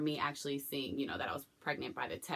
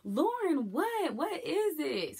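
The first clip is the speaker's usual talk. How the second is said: much higher, alarmed mother asking; worried mom; 11 semitones higher, much slower than usual. Retold or imitated. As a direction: voice a startled mother pressing her daughter. Mother voiced, worried questioning.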